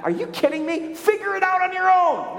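mock an impatient God waving you off; mock dismissive God. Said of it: "No, God doesn't speak like that".

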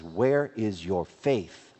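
each word drawn out and slow, low and firm; a slow, measured reproach; slow rebuke. Retold or imitated. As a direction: ask it slowly like a gentle rebuke. Jesus rebukes the disciples.

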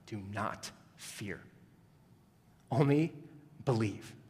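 soft, slow and hushed; "Do not fear. Only believe." breathed quietly as comfort; preacher quoting Jesus to the grieving father, softly; gentle reassurance. Acted.

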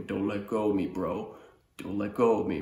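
lightly voiced grown teen, at normal pitch; understated bro-to-bro pleading; seventeen-year-old's plea. Seventeen-year-old on the bike begging his brother, lightly played plea. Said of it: "it's like a seventeen-year-old man going".

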